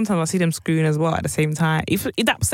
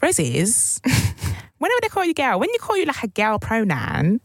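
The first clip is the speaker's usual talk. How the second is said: higher-pitched imitation of a cockney girlfriend; 6 semitones higher than usual. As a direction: put on a light cockney, pitched up. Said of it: "I s-, made her sound quite cockney".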